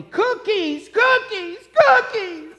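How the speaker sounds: put-on high, loud child shout; toddler yelling. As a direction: scream it like a tantrum. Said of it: "she keeps yelling at Mommy".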